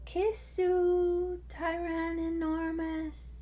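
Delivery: put-on high, cooing toddler voice; baby dinosaur voice. Terry greets her big brother, high cooing baby voice. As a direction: lisp it sweetly like a tiny sister.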